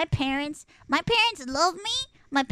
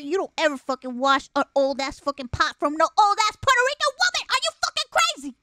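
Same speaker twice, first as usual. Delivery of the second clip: exasperated scolding; 5 dB louder, faster than usual. Retold or imitated. Sibling scolding sister over washed old pot, rising outrage.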